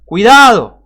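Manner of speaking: high, loud cry of alarm; warning shout. Acted. Preacher voicing the one who warns, shouting. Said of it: "Primero grita el que dice".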